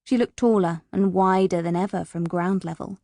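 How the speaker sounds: ordinary delivery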